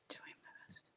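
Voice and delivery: trailing off into a whisper; whispered aside. Real, not acted. Reading confusing chat, she whispers to herself. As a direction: mutter it under the breath.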